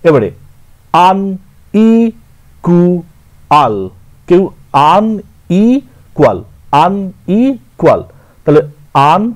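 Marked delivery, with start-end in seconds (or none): 1.1-3.8 আনইকুয়াল stretched very slowly; teacher slowly sounding out আনইকুয়াল; syllable drill. Shown as a demonstration. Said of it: "A ট্রাইসিলেবল". 5.0-8.0 আনইকুয়াল said slowly, syllable by syllable; slow sounding-out of আনইকুয়াল; teacher repeating আনইকুয়াল slowly; syllable drill. Shown as a demonstration. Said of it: "A ট্রাইসিলেবল".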